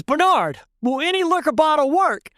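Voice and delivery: high-pitched student's question, imitated; eager questioner. Student asking Bernard from the class, eager questioner.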